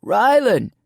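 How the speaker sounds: loud call across the woods; distant searcher calling Rylan. Acted. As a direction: shout the name to find her. Searchers calling her name.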